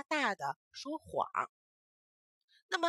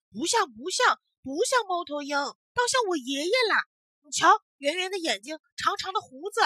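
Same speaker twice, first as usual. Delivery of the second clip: put-on loud exclaiming child; 8 semitones higher, 8 dB louder than usual. Boy exclaiming loudly.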